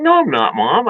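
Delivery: high-pitched mock denial to Mom; protesting kid. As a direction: imitate a kid protesting, voice jumping up. Acted childhood denial to mom.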